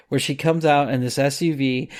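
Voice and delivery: normal talking voice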